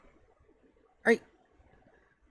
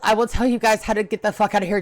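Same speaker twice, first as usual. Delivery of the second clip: ordinary talk, nothing marked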